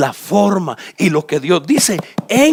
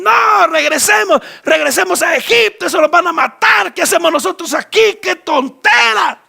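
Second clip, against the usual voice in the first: high, shouted complaint, slowed and dragged; wailing people; 11 semitones higher, 3 dB louder than usual. Acted. The frightened people cry out to go back to Egypt. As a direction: whine and shout to turn back. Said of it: "se ponen a llorar y dicen".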